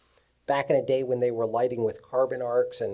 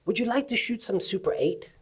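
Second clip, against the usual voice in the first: higher-pitched take on an English director; 7 semitones higher than usual. Retold or imitated. English director offering the cameraman Super eight, lightly imitated English voice. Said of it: "he's an English guy".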